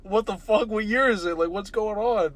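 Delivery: high, loud, panicked questions; panicking. Pretend. Waking up centuries later, asking what year it is, panicked and loud. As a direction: blurt it out in sudden panic, voice way up. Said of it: "You're just panicking".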